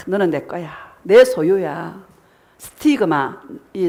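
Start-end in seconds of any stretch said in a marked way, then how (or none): 0.1-2.0 the Lord speaking softly to his bride; tender claim. Retold or imitated. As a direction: murmur it like a loving promise.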